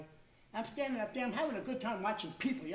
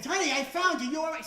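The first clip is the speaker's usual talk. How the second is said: higher, louder mock-concerned call; calling out to wife; 6 semitones higher, 7 dB louder than usual. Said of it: "And I, I".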